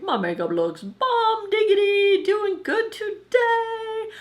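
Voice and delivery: high, bright, pleased with herself; excited self-quote. Retold or imitated. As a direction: gush over your own makeup. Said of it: "and being like".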